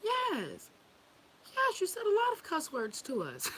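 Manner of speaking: very high, loud mock kid voice; hammed-up high child's complaint; imitated children. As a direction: squeal it like an aggrieved child. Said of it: "They'd be like".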